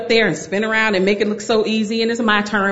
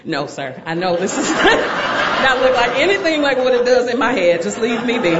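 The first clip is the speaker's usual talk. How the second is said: laughing embarrassed refusal; 4 semitones higher than usual. Retold or imitated. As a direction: laugh it off, declining.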